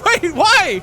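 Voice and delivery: ordinary talk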